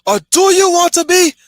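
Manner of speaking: high, loud, rallying battle cry; rousing speech. Retold or imitated. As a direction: shout it to an army before the fight. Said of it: "the line at the end of this speech".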